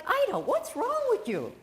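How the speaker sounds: high, bewildered question; baffled suitor. Retold or imitated. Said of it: "And he's like".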